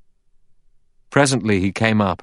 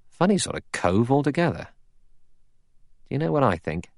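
higher, confiding suspicion; pitched-up, insinuating Blore; suspicious hinting; 7 semitones higher than usual. A narrator's reading. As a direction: lead up to a private suspicion. Blore hints his suspicion.

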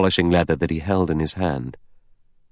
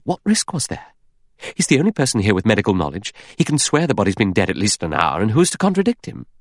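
quick, high-pitched impatience; impatient; 4 semitones higher, faster than usual. Acted. Vera brushing aside Lombard's doubt, impatiently. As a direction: rattle off the argument, pitch jumping up. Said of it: "Vera said impatiently".

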